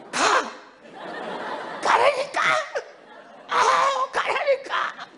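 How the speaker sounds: very high, frightened squeal; hammed-up panic, shrieking it away; panicked shooing. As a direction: squeal in mock terror at a scary dog. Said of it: "개는 무서워가지고 덜덜 떨고".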